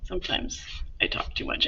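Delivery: whispered, half-joking admission; teacher whispering that she talks too much; whispered confession. Real, not acted. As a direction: whisper it like a guilty secret. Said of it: "I'm like a bunch of blah-de-blah".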